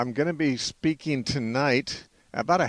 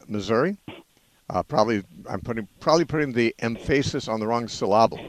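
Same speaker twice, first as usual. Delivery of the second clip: ordinary delivery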